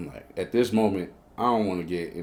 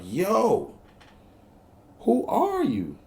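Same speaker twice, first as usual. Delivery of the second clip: high-pitched incredulous question; retold disbelief, pitch leaping up; amazed; 11 semitones higher, much slower than usual. Man floored by a woman's Sega, amazed.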